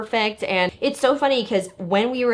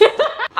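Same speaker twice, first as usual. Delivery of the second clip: short, much higher giggle; high burst of laughter. Real, not acted. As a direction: break into a quick high laugh. Laughs at her own joke.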